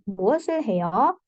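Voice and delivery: quick question with 해요 rising at the end; question intonation. Shown as a demonstration. Teacher repeating the question example for students, rising intonation on 해요. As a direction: lift 해요 upward to ask. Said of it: "Kalau pertanyaan nadanya naik".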